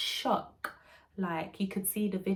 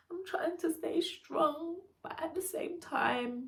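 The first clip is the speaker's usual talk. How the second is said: higher, slower, close to tears; voice breaking; 10 semitones higher than usual. Real, not acted. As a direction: fight the tears as you say it. Repeats line 3 close to tears.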